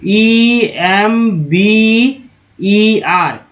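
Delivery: letters spelled out very slowly; slow letter-by-letter spelling for learners. Shown as a demonstration. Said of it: "एक word meaning ले लेते हैं remember का".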